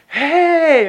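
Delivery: loud, high-pitched shout of hey; hearty greeting. Retold or imitated. Group hailing a shaky newcomer, loud greeting. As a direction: call out an overeager welcome.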